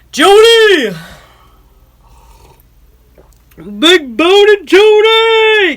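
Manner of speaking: high, loud sing-song call across the room. Real, not acted. Calling out to Jody across the house, loud sing-song call. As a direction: belt her name out, stretched slow.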